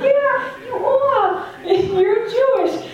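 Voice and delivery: high, loud laughing reassurance. Real, not acted. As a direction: laugh it out with delight.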